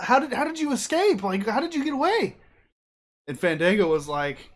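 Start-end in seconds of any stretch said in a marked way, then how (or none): 0.0-2.4 high-pitched, mock-incredulous questions; amazed questioning. Breeze asking about the escape, astonished. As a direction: press him, astounded, voice raised.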